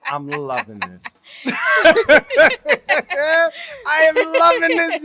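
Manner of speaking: loud, high, gleeful through laughter; delighted laughing. Real, not acted. Husband relishes wife's recorded confession, laughing with delight. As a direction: crow it while cracking up, drawn out.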